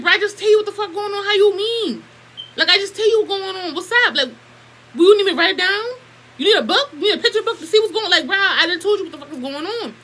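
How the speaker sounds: high, loud exasperated retort; mocking high-pitched exasperation at the mom; retold exasperated rant. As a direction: fire it up high at the mother, fed up. Retold angry retort to mother.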